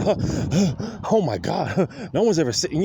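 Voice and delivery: hammed-up gasps of excitement; mock hyperventilating. Imitating the clerk hyperventilating over a compliment, mock gasping. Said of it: "she started hyperventilating".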